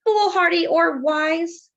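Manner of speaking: slow, ringing emphasis; emphatic. Acted. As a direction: lean into each word. Reading author's note.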